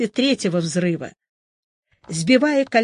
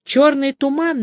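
ordinary delivery, nothing marked

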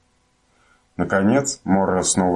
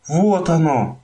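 excited cry; 10 semitones higher, slower than usual. A narrator's reading. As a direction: cry out with joy. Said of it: "вскричал мумитроль".

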